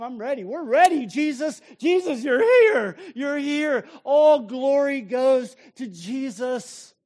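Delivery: tearful shouted welcome; crying out joyfully. Acted. Preacher voicing John's joy.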